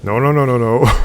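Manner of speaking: real alarm at a near fall; panicked no. Real, not acted. Nearly slipping off ledge.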